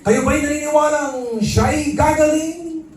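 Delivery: high, drawn-out rally preacher cry; hammed-up healing-rally shout; shouting faith healer. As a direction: stretch the words out to a huge arena. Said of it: "At sumigaw siya".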